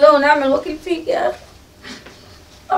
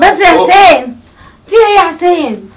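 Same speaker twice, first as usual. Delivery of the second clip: loud, slow cry to stop him; pleading shout; 3 semitones higher, 9 dB louder, slower than usual. Acted. Mother intervenes in quarrel.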